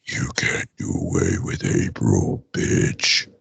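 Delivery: very low, slow, rasping whisper; mock-ominous voice. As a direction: growl it like an undying menace. Plays the undying robot.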